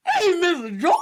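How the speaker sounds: high, loud put-on voice of the maid; mocking maid voice. Mocking the maid's line to the co-host, high and loud.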